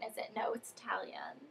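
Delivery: a whispered, voiceless correction to herself; whispered aside. Real, not acted. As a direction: mutter it under your breath as a side note.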